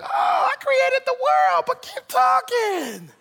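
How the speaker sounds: high, drawn-out mock exclamation; sarcastic God. God answering a busy believer, sarcastic high holler. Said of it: "He's like".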